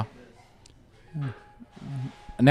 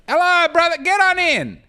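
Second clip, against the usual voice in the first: higher, warmly inviting host; welcoming host voice; 12 semitones higher than usual. Acted. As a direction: beckon them in like a jolly host. Greeting arriving guests in character.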